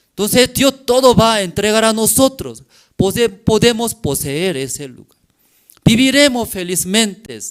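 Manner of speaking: loud, high rallying plea; preacher acting out a rousing appeal; urgent exhortation. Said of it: "Solamente transmitieron la palabra de Dios".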